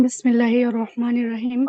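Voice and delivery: बिस्मिल्लाहिर रहमानिर Rahim said fast; rushed recitation. Real, not acted. Student rushes, teacher wants it calm. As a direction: hurry through the words. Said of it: "इत्मीनान से".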